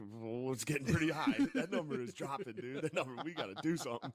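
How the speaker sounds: half-laughing pretend alarm; mock alarm. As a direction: play a worried technician watching the dial. Acting worried Chernobyl control room.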